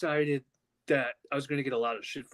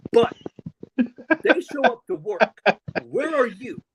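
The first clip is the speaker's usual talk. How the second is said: rant; 5 semitones higher, 7 dB louder, slower than usual. Real, not acted. Ranting at Punk.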